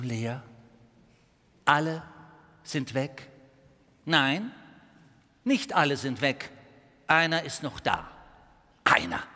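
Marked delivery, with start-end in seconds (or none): 1.6-9.2 suspenseful narration. A narrator's reading.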